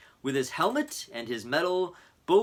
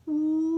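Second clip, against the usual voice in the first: high loud siren woo; high siren imitation; siren wail; 4 dB louder than usual. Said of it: "waited for the siren".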